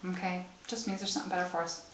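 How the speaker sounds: a soft, hurried echo; muttered repeat. Retold or imitated. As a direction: quickly murmur it back to yourself. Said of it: "repeating daughter's line".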